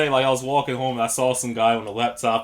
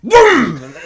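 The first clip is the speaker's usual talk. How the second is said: boom; 9 semitones higher, 11 dB louder than usual. Retold or imitated. Dog thief sprints off.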